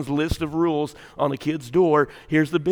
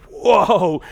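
loud, higher cry of alarm; startled whoa; 4 semitones higher, 7 dB louder than usual. Retold or imitated. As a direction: act out a startled little child. Acts out startled granddaughter's reaction.

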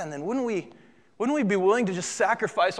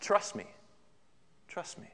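hushed plea, fading softer; reassuring Christ; 10 dB quieter than usual. Acted. Christ to us in hard circumstances, softly reassuring. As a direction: say it quietly, leaving space after each. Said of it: "Christ's message to us in our circumstances".

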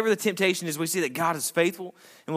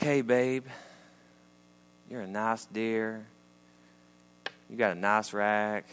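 mock sweet-talk; 4 semitones lower, 5 dB quieter, slower than usual. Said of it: "speaking to my wife like this".

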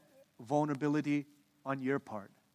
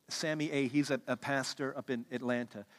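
usual speaking voice

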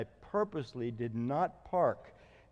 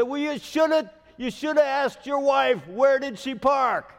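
much higher and louder mock yell, slowing at the end; imitating yelling neighbor; 9 semitones higher, 8 dB louder than usual. Neighbor scolding pastor about his wife's car, loud mock yell. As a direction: scold him like a furious neighbor. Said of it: "He's yelling at me".

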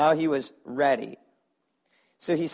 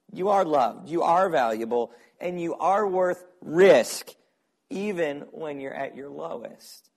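fervent preaching; 3 semitones higher, 5 dB louder than usual. Acted. Impassioned preaching of belonging.

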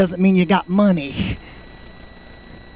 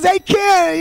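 much higher, taunting sneer; mocking brother-in-law; 12 semitones higher, 4 dB louder than usual. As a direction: make fun of the kid. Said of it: "he's making fun".

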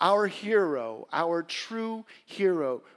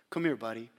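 normal talking voice

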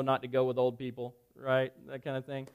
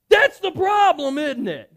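loud, high, drawn-out outburst; retold losing his cool; 11 semitones higher, 12 dB louder than usual. Man replaying his outburst at the girl, loud angry shout. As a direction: blow up in a slow, high shout. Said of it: "I lost my cool with this girl".

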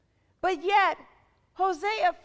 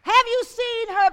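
higher and louder, falling at the end; preaching challenge; 6 semitones higher, 9 dB louder than usual. Real, not acted. Preacher challenging the church to see her, loud. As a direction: hit Have hard and call it out.

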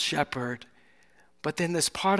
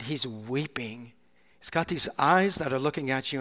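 usual speaking voice

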